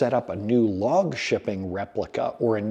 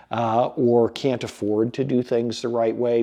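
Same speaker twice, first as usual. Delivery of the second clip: normal talking voice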